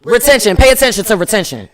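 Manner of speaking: loud, punchy Retention. slogan; announcing the word again loudly, with a laugh; for the people in the back. Real, not acted. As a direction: shout it so the back row hears. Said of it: "For the people in the back".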